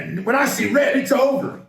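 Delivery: hammed-up tough-guy build; rising mock threat. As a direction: pitch climbs high on it's over.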